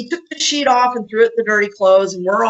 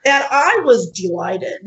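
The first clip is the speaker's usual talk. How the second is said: ordinary delivery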